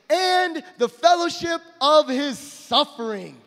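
slow ringing scripture line; emphatic quote. Real, not acted. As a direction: land the verse slowly and strongly. Quoting the rest of the verse.

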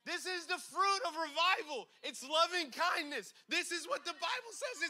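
real conviction breaking through; fired up. Real, not acted.

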